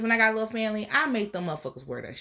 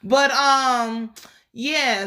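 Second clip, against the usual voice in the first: usual speaking voice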